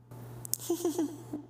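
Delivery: breathy high ghost giggle far off; an eerie baby giggle from nowhere; ghostly filly giggle. Acted. Unseen ghost filly giggles at the ponies, eerie and high. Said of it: "familiar laugh of the ghost of the newborn filly".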